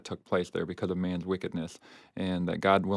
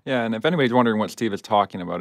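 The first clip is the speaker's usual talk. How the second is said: ordinary delivery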